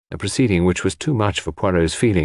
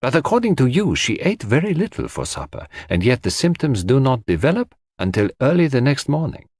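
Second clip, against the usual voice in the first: put-on higher-pitched, animated accented detective; Poirot, reasoning; 6 semitones higher than usual. A narrator's reading. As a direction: reason it through emphatically, point by point.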